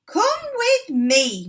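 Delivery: high, loud and slow command; yelling. A narrator's reading. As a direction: order him along. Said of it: "Mister Cranky yelled".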